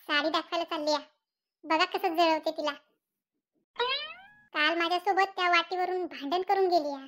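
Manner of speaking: ordinary talk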